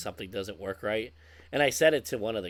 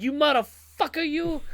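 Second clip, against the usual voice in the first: high, far-off screaming mock Pesci rant; Joe Pesci rage; 6 dB louder than usual. Camper owner imitating Joe Pesci over a leaking sink, shrieking curses. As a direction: shriek it like a gangster losing it. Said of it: "like Joe Pesci's character in Casino".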